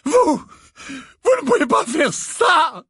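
high, loud desperate protest; crying out. A narrator's reading. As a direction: cry out against the sentence. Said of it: "s'écria le professeur Trelawney".

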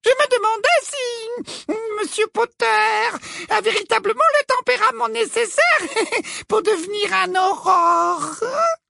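honeyed falsetto with a titter; sugary voice. A narrator's reading. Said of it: "d'une voix doucereuse".

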